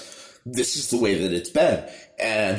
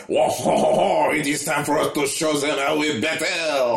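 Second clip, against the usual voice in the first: boisterous mock-French dwarf battle cry; French-accented dwarf; 6 semitones higher than usual. Dwarves coming in to battle, hammed-up French accent. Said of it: "speaking in French accents".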